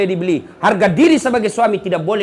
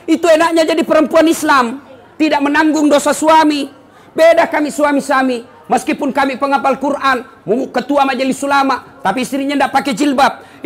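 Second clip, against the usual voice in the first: high, loud preaching; fiery preaching; 8 semitones higher, 4 dB louder than usual. Acted. Preacher rousing the congregation in a high, loud preaching voice. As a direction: preach it out to the crowd. Preacher rises to full force.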